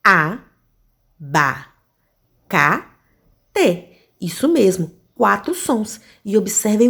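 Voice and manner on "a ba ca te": A, ba, ca, te as separate beats with long pauses; teacher counting syllables aloud with the class. Shown as a demonstration. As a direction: count abacate out one syllable at a time. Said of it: "vamos contar quantos sons eu pronunciei?".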